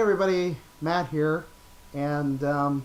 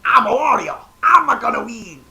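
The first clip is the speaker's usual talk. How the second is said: higher, louder gruff cartoon Italian accent; Wario; 6 semitones higher, 10 dB louder than usual. The evil twin boasting he'll win, cartoon Italian accent. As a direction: gloat like the evil twin plumber. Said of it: "They call him Wario".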